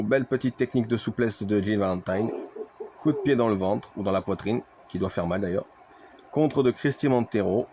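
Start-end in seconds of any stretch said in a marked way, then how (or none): 4.9-7.7 wrestling commentator. Acted.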